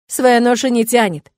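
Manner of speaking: loud, slow proud call; shouting out. A narrator's reading. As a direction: shout it for everyone to hear. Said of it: "выкрикиваю". (громкий, медленный выкрик)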